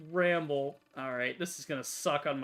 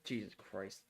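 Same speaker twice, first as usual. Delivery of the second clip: ordinary talk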